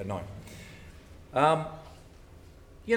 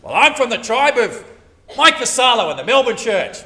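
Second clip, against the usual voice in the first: loud, higher mock brag; mock pride; 7 semitones higher, 11 dB louder than usual. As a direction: brag about belonging. Voicing a proud church member.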